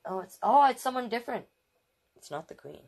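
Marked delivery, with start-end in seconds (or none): 2.2-2.8 soft whispered correction. Real, not acted. Reader whispers the surprise to the child. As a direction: whisper it, almost no voice.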